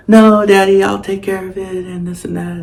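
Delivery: put-on high, eager little girl voice; a child pleading high. Daughter quoted promising dog care.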